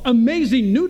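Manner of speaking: announcer read; radio announcer voice. Pretend. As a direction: sell it like a radio ad. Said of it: "I almost sound like a radio announcer".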